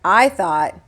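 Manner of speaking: plain reading voice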